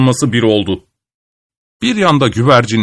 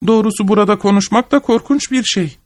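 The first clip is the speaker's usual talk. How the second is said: high chirpy bird voice, far above the narrator's own; chirping bird; 9 semitones higher than usual. A narrator's reading. Ağacın üstündeki kuş dedeme cıvıl cıvıl, ince ve yüksek sesle. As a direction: chirp the line like a tiny bird. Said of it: "diye cıvıldadı kuş".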